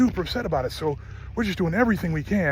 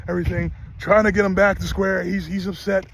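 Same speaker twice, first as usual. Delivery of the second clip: ordinary delivery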